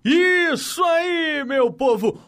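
high, drawn-out joyful shout; excited shout. Real, not acted. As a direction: belt it out like a TV host celebrating. After the goal clip. (grito animado de apresentador)

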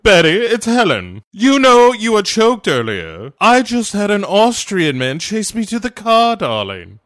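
mock gossip lady; gossipy lady. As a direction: dish it out like a society lady.

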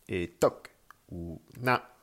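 TOC and NA cut short, falling; "et toc", "na" as dry little exclamations. Shown as a demonstration. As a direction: drop "toc" and "na" curtly from above. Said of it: "Il se dit de façon assez sèche".

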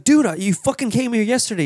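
high-pitched, indignant acted confrontation; reenacted exasperation at an unwanted caller; exasperated retort. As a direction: confront the repeat visitor, voice jumping up. Retold confrontation at the door.

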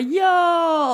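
high, loud eager cheer. Retold or imitated. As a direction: cheer like a thrilled toddler. Saga cheering before spitting.

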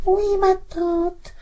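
put-on very high, slow girlish voice; the cat squeaks like a young girl; cat faking the girl. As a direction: tomcat imitating a young girl's voice. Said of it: "répondit".

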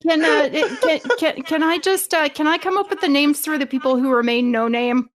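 ordinary talk

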